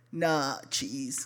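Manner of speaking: low, quiet brush-off; low-key refusal. Retold or imitated. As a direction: turn her down in a low undertone. Boyfriend coolly refusing to share.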